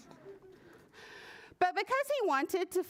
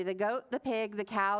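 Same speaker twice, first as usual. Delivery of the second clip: ordinary delivery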